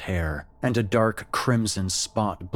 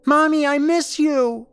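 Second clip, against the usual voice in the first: put-on much higher, loud child crying for mommy; child calling; 9 dB louder than usual. Creature voicing her little son calling for his mother, high and loud. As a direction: call like a small boy. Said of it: "with a much higher voice".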